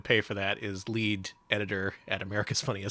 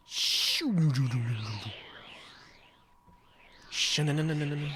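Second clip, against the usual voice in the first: pew pew zaps; 3 semitones higher than usual. Retold or imitated. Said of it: "The laser noise!".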